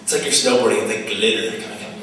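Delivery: ordinary talk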